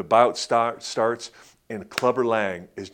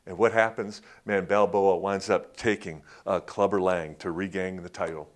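ordinary talk, nothing marked

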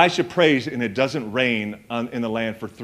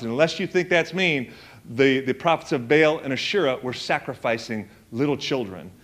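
ordinary talk

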